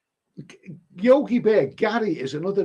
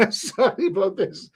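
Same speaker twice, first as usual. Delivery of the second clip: laughing apology; 6 semitones higher than usual. Real, not acted. Laughs apologizing for distrusting Steves.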